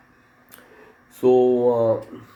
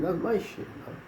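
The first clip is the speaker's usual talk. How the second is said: ordinary talk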